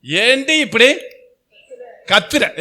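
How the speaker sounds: high, loud mock scold of a sister. Preacher voicing Mary scolding her sister, mock loud scold. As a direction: snap back at the shouting sister, half in jest. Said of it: "என்ன பண்ணிருக்கலாம் மரியாள்".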